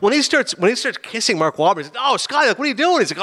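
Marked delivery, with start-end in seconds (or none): 2.0-3.0 high-pitched mock startled protest. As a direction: act out recoiling from an unwanted kiss. Acts out rejecting a kiss.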